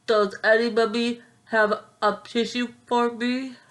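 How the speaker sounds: small stuffed-up voice asking for a tissue; stuffy flea voice. Acted. Flea begging the farm animals for a tissue, stuffy. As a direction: plead like a flea with a blocked nose. Flea's stuffy plea in story.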